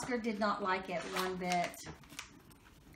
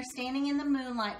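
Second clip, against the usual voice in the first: plain reading voice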